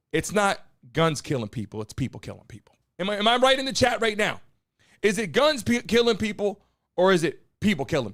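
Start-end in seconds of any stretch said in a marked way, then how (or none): none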